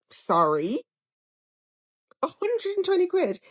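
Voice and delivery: a high, disbelieving repeat of the price; incredulous. Real, not acted. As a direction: ask it back as if you can't believe it. Shocked at grocery bill.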